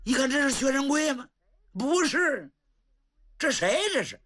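high, crying shock at the sight; can't recognise the beaten man, voice breaking. Acted. Old general sees his battered godson, crying out high. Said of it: "程咬金热心肠".

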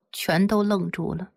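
usual speaking voice

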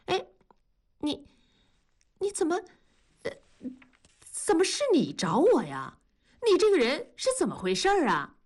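sudden high startled voice, stammering; surprised. Acted. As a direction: caught off guard, voice jumping up. 文丽意外见到同志. (惊讶地结巴)

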